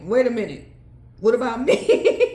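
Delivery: a hint of a man demanding his share; indignant masculine. Divine masculine complaining he's left out, mock indignant. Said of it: "He showed up like".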